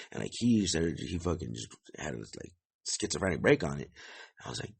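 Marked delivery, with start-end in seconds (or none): none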